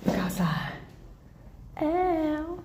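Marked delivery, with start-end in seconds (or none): 1.8-2.6 sharp high ow; short pained yelp. Real, not acted. Yelps stepping into snow.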